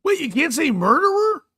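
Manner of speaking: high, loud disbelief; wait, really?; startled question about a banned word; surprised. Real, not acted. Incredulous at a censored word.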